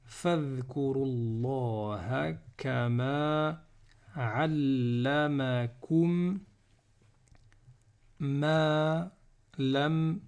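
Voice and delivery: very slow, segmented verse reading; Quran recitation. Shown as a demonstration. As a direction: recite with long sustained vowels and pauses.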